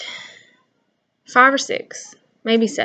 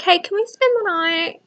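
put-on sweet kid ask; 9 semitones higher than usual. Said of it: "be like".

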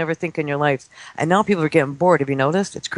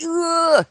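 high imitated squeal of excitement; excited squeal; 12 semitones higher than usual. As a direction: squeal like an overexcited little kid. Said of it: "He was so excited".

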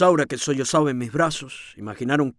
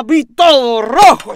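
slow, much louder, seething with fury; blind rage; 7 semitones higher, 10 dB louder, slower than usual. A narrator's reading. Lodger recalling the moment he saw red, furious. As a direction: let the anger boil over each word.

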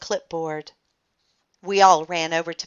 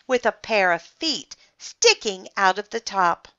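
ordinary delivery, nothing marked

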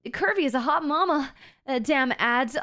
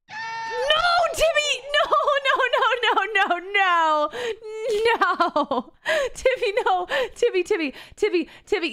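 panicked calling; 7 semitones higher than usual. Real, not acted. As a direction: cry out to stop Timmy, then calm.

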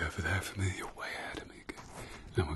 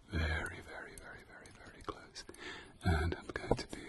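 whispering; 4 semitones lower, 7 dB quieter, faster than usual. Acted. Leaning in very close.

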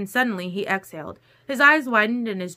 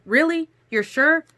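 high, louder exasperated questions; 8 semitones higher, 6 dB louder than usual. A narrator's reading. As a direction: throw it back in exasperation. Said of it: "hands up in exasperation".

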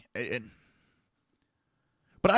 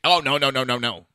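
louder, hammed-up no no no; mocking refusal; 3 semitones higher, 7 dB louder than usual. Firemen asked to risk themselves, a mock panicked refusal. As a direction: mimic firemen backing off fast.

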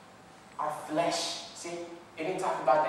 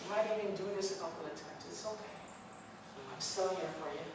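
much softer, gentle and encouraging; calm soothing God; 11 dB quieter, faster than usual. Acted. God encouraging Ben, much softer and soothing. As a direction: speak softly like a loving father. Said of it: "so soothing and calming".

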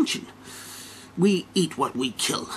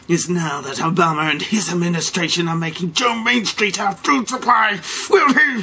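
climactic challenge; 5 semitones higher, 6 dB louder than usual. Acted. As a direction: build to a menacing peak. Climactic threat ending the rant.

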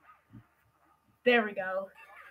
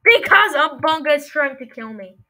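loud plea for help; 8 dB louder than usual. Real, not acted. Monster chasing him.

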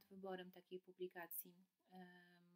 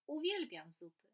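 delighted rabbit; 5 semitones higher, 9 dB louder than usual. Acted.

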